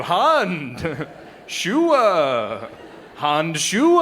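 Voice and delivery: mock German accent. Shown as a demonstration. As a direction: show off a German word in a jokey accent. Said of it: "you say 'em in a funny voice".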